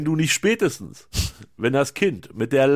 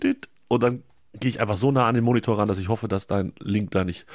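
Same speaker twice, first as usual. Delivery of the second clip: usual speaking voice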